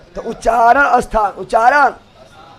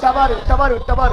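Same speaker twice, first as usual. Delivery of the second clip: normal talking voice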